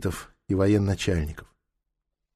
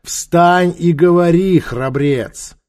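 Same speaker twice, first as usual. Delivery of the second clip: loud, slow, raised order; impatient command; 7 semitones higher, 6 dB louder, much slower than usual. A narrator's reading. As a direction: cut in with a ruler's command. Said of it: "Трок проявил нетерпение".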